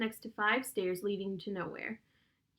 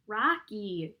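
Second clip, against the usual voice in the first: plain reading voice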